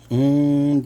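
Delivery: loud, breathy mmm of delight; loud savoring mmm. Real, not acted. Tasting the gravy.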